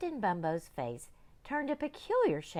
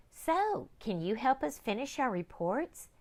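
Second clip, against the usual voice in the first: put-on high eager girl; high child's voice; young girl; 7 semitones higher than usual. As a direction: ask like a hopeful young girl.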